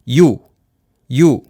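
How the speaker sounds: you said in one clear loud glide; model syllable you. Shown as a demonstration. Teacher showing students how you is pronounced. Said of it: "On prononce comme ça".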